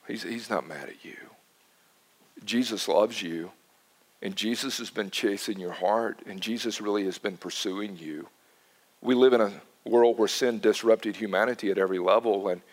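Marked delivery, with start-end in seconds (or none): 0.1-8.3 soft reassurance. Real, not acted. Comforting a grieving widow.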